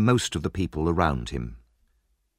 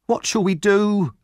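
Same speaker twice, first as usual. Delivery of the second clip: slow, high wail of dismay; panicked, drawn-out high cry; crying out in alarm; 11 semitones higher, 4 dB louder than usual. Acted. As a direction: wail helplessly for advice. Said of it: "cried Missus Sowerbury".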